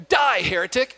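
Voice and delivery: mock fury. Punchline, man turns violent.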